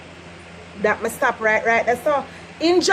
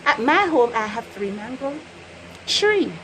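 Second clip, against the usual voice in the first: usual speaking voice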